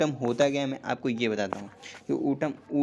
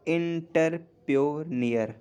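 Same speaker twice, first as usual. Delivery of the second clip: "Entrepreneur" stretched slowly, a bit higher; slow careful demo; 4 semitones higher, much slower than usual. Shown as a demonstration. Teacher slowly saying the new word for students. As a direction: draw out "entrepreneur" for the class. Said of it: "inter पे".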